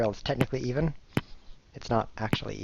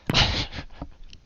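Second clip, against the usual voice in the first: real laugh. Real, not acted. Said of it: "Look, I'm lolling".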